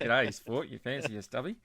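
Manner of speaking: loud, hammed-up Aussie accent; Australian accent. Playing up his one accent for co-host, Australian accent. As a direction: greet a mate in broad Australian. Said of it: "That's one accent".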